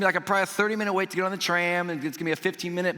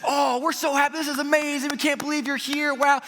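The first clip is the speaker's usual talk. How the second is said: high, hammed-up delighted welcome; gushing excitement; 10 semitones higher, 3 dB louder than usual. Disney lady greeting the guest of the day, gushing excitement. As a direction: gush as if overjoyed to meet them.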